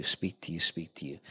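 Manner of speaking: low, soft echo fading away; hushed repetition. Shown as a demonstration. Pastor evoking God speaking to you, hushed and fading.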